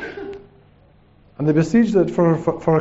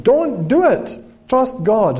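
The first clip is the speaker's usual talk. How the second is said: high, drawn-out urgent cry; mock scream, pitched well up; screaming warning; 5 semitones higher, much slower than usual. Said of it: "screaming at them".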